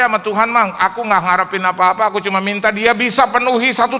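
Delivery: loud earnest preaching; fervent preaching. Acted. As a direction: plead earnestly. Preaching at full force. (berkhotbah dengan sungguh-sungguh)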